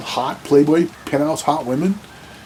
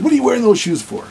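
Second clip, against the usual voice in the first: high, loud mock-incredulous question; incredulous cop; 11 semitones higher, 4 dB louder than usual. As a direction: toss it up high in disbelief. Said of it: "and he says".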